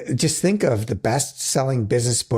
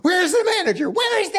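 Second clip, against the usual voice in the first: high shouted demand for the manager; angry customer shout. Pretend. Co-host joins the bit as a furious shopper, high shout.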